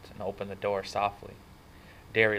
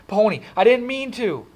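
screamed; 14 semitones higher, 10 dB louder than usual. A narrator's reading. Brother calling after kid running away, screaming. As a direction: scream after him in regret. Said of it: "Derry screamed".

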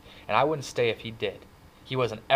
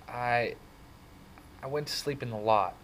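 hesitant, halting start with a long pause; stammered; 3 semitones higher than usual. A narrator's reading. Kid explaining himself to angry brother, stammering. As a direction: falter before confessing. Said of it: "when I stammered".

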